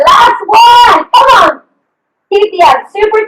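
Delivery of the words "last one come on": louder, higher coach's push; rally the class for the last set; cheering shout. Real, not acted. Coach pushing the final set.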